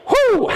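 a high, loud whoop of joy; sudden high cheer; excited whoop. Real, not acted. Coach cheering mid workout.

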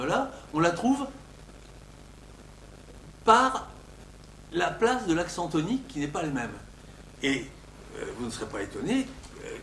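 ordinary talk

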